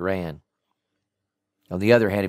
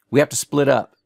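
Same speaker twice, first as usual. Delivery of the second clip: tough agent; 6 semitones higher, 6 dB louder than usual. Acted. D J voiced.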